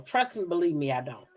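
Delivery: usual speaking voice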